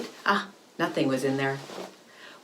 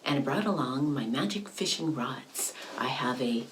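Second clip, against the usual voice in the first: usual speaking voice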